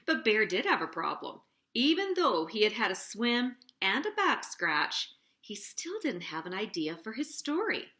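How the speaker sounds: plain reading voice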